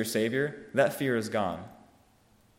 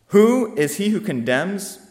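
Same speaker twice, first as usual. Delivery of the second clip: higher, louder and slow, each word driven home; booming rhetorical question; 7 semitones higher, 7 dB louder, slower than usual. Acted. Preacher challenging the congregation, slow booming question. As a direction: call it out across the hall.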